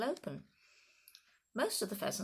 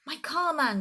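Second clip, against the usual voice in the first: much higher, loud desperate shout; 9 semitones higher, 9 dB louder than usual. A narrator's reading. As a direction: cry out for your precious car. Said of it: "shouted Mister Hazel".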